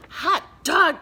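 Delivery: loud, high cheer; cheering kids. Acted. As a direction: shout it like kids who won. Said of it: "cried the kids".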